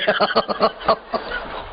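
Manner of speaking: high breathy laugh, rising; infectious laughter. Shown as a demonstration. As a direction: start giggling to set everyone off. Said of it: "चेतन चुप कैसे रहे?". (चढ़ती हुई संक्रामक हँसी)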